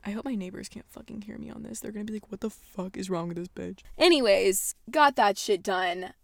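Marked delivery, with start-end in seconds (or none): 0.0-3.8 much softer, as if neighbours might hear; quietly wary of being overheard; hushed aside. Real, not acted. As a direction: drop to a soft, self-conscious aside. Worrying the neighbours can hear her.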